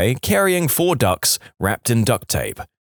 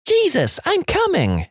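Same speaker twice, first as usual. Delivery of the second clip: mock scream.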